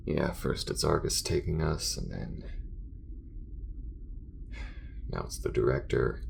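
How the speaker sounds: grudging compliment, then tired venting; halting, worn-out talk to a partner; fed up but easing into banter; weary space crewmate. Acted. Acted character talks with crewmate.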